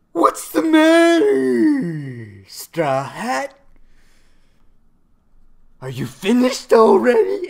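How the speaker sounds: loud, high, drawn-out villain taunt; mock taunting yell. Katakuri taunting a fallen Straw Hat, high mock yelling.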